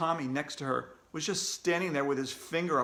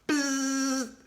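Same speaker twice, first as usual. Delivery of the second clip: nasal-free flat buzz imitating a quiz buzzer; 10 semitones higher than usual. A narrator's reading. Reader imitates the quiz buzzer, steady buzz. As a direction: make the buzzer noise with your lips.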